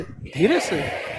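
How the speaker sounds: low, soft धीरे से; softly. Shown as a demonstration. Class answering the teacher softly. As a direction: say धीरे से hushed. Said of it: "कैसे".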